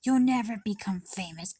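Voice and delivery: loud mocking imitation of mom; mom's put-down. Girl mimicking her mom's put-down, loud and mocking. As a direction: bark it like the mother crushing her dream. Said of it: "she said".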